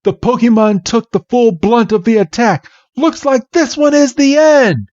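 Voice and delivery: high-pitched dramatic commentary; hammed-up spectator dismay; alarmed crowd. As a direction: call it out in mock alarm, climbing to the finish. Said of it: "the whole crowd's like".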